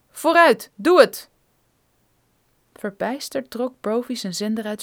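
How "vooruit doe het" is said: loud, higher, forceful command; barked order. Acted. Armed man ordering Brophy to use his radio, loud. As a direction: bark the order, no patience.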